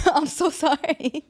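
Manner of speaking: laughing apology. Real, not acted. Laughs at own joke.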